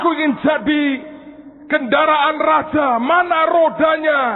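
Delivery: high, loud complaining cry; indignant shout. Acted. Tree demanding the wheels it was promised, shouting. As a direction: demand your wheels back. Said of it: "Dia berkata".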